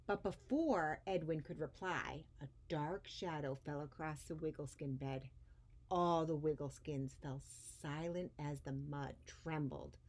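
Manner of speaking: put-on lively read-aloud voice for children; expressive storybook reading; story narration. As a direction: read it like a picture book to a class. Reads picture book aloud to kids.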